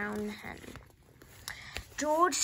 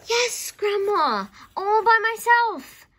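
high, very loud, drawn-out reply; shouting up; 12 semitones higher, 11 dB louder, slower than usual. A narrator's reading. George answers Grandma on the roof, shouting. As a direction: holler the answer up proudly.

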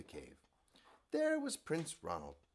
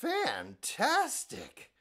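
mock amazement; 11 semitones higher, 6 dB louder, much slower than usual. Elizabeth praising the dragon.

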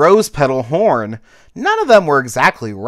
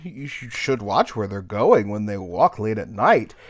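put-on rumbling old caterpillar; 4 dB louder than usual. Old caterpillar gently warning Cricket, rumbling. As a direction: rumble it like a trombone. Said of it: "he rumbled like a bass trombone".

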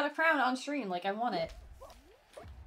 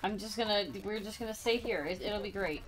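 ordinary delivery

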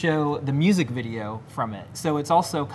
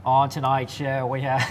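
a slow, badly faked Boston accent on the show intro; hammed-up Boston twang, slow. Co-host intros the show in a bad Boston accent. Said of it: "that's a bad accent".

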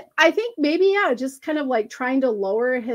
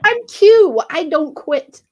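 defiant contestant; 5 semitones higher, 9 dB louder, slower than usual. Retold or imitated. Acting out Q's defiant quote.

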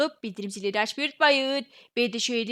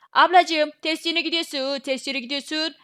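loud call, higher pitch; loud, higher call to a stranger; 4 semitones higher, 5 dB louder, slower than usual. Acted. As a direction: shout to get her attention. Calling out to another character.

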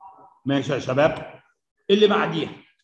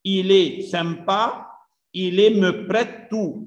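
slow reading; much slower than usual. Shown as a demonstration. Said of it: "teacher rereads sentences".

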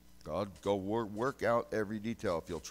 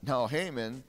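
much louder revival preaching; 4 semitones higher, 11 dB louder than usual. Acted. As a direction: drive it home to the congregation. Preaching at full force.

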